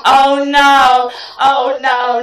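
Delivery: loud, wailing oh no; a loud, hammed-up lament; dismay. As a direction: cry out in comic despair. Dismay at bra size.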